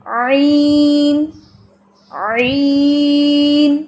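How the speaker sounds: عین very slow, long, kept thin; thin soft ain. Shown as a demonstration. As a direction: draw عین out softly, not thick. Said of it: "یہ آواز اس میں باریک ہے، نرم ہے".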